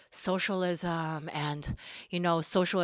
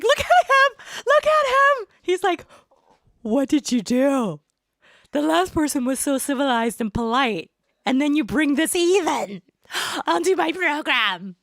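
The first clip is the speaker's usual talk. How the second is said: much higher, louder alien squeal; mock alien shriek, much higher and louder; imitating aliens; 8 semitones higher, 7 dB louder than usual. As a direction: squeal like an outraged alien host. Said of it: "all the aliens are like".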